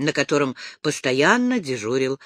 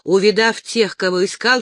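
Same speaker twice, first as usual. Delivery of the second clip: usual speaking voice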